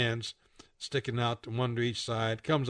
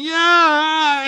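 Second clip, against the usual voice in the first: loud high drawn-out yell; screaming; 13 dB louder than usual. A narrator's reading. Licked helpless Red cries out, screaming. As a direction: let out a long comic wail. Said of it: "Red is screaming".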